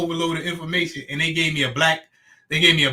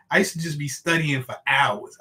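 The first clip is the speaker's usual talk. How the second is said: normal talking voice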